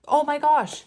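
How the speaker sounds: high, loud oh my gosh; excited exclamation. Real, not acted. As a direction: burst out with the sudden memory. Sudden excited realization.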